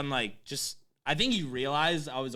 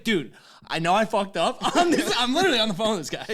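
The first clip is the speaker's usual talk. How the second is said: higher, louder, laughing as the story is retold; amused, pitched-up exasperation; 6 semitones higher, 5 dB louder than usual. Real, not acted. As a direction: laugh through a retold plea. Laughs retelling his phone plea.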